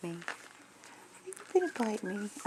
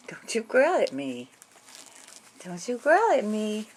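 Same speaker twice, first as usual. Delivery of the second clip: mock scolding; 2 semitones higher, 7 dB louder, slower than usual. Mock scolding the dog slowly.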